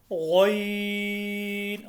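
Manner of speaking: गौइन with throaty gh, rounded o, long ee; thick, lengthened. Shown as a demonstration. Teacher shows learners a heavy letter, गौइन stretched. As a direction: sustain the ee in गौइन. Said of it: "इसको मोटा करके".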